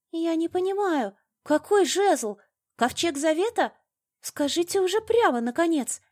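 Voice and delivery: very high, loud, frightened protest; desperate plea. A narrator's reading. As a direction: plead for your life, bewildered. Terrified captive pleads at gunpoint.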